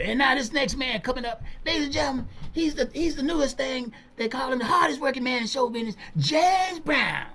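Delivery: high showman's introduction; MC announcing. Retold or imitated. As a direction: announce him like a big stage act. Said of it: "He said".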